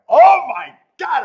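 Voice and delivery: a sudden loud, high "oh my God"; shocked shout. Retold or imitated. To the caller who wants to spar, a loud shocked shout. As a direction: blurt it out in disbelief. Retold reaction to sparring offer.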